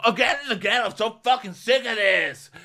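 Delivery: high, loud mock rant; put-on furious complaint; raging. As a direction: imitate someone storming past, fed up. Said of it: "he walks off raging".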